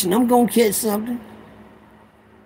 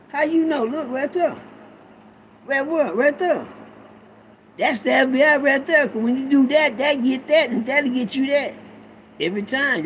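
higher, excited back-and-forth voice; mock know-it-all bystander, pitched up; acted onlookers; 6 semitones higher, 3 dB quieter than usual. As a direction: play bystanders arguing over what they see. Acted dialogue between two onlookers.

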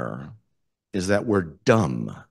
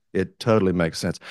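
ordinary delivery

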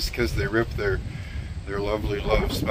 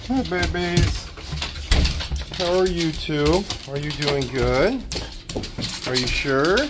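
high, slow sing-song voice for pets; 5 semitones higher than usual. Real, not acted. Greeting the babies at home in cooing baby talk. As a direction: coo at the little ones.

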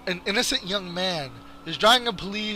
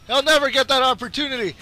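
higher-pitched insistence; insistent; 5 semitones higher, faster than usual. Real, not acted. Gamer vowing to stop Dragon Faust, insistent and high. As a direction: push the repeat up in pitch.

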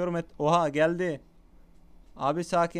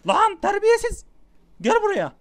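high-pitched, very loud shout; real full-volume scolding shout; excited yelling; 11 dB louder than usual. Real, not acted. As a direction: yell furiously at the attacker, voice leaping up. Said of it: "Bağırıp çağırıyorum ama çok heyecanlı yani".

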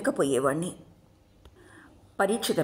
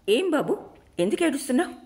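higher-pitched, caring woman's questions; concerned teacher; 9 semitones higher, 3 dB louder, faster than usual. A narrator's reading. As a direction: voice a teacher asking a crying child, pitched up. Teacher gently questions crying child.

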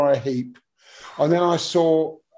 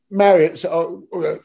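usual speaking voice

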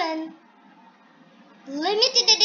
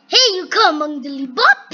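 usual speaking voice

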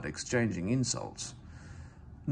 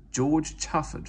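ordinary delivery, nothing marked

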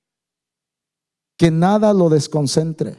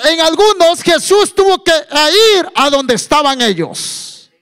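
raised preacher's voice climbing higher; preaching, building; 7 semitones higher, 5 dB louder than usual. Acted. Preacher tells the congregation Jesus visits the cities, building preaching. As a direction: build from raised teaching into forceful proclamation.

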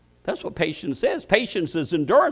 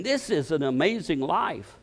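ordinary talk, nothing marked

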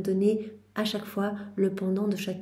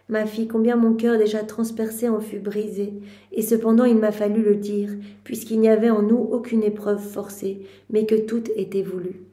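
louder, earnest devotional reading; fervent reading; 4 dB louder than usual. Acted. Prayer leader reading Mary's lament to the group, louder. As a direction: read it out with rising fervour. Reads Mary's words to her daughter.

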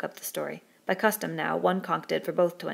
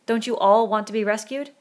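loud, higher rising question to a crowd; urgent appeal; 4 semitones higher, 8 dB louder than usual. A narrator's reading. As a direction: appeal to the whole assembly. Ralph appeals to assembly.

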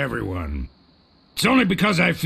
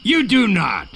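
put-on childish quarrel; 5 semitones higher, 4 dB louder than usual. Childish back-and-forth quarrel.

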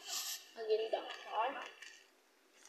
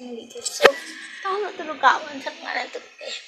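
normal talking voice

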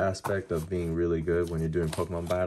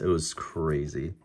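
normal talking voice

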